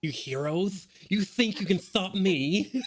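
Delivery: high, slow laughing villain; hammy villain. Pretend. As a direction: ham up the villain taunt.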